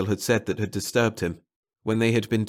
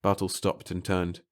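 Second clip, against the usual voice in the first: plain reading voice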